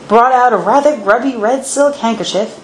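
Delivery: ordinary delivery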